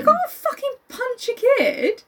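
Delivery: high, slow, incredulous protest; appalled squawk, way up in pitch; stretched-out high-pitched disbelief; real outrage. Real, not acted. Shocked reaction to the story.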